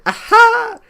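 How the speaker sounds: high, loud gloating "Aha!" with a laugh; triumphant laugh. Retold or imitated. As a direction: crow with glee at the loophole. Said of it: "And then Congress was like".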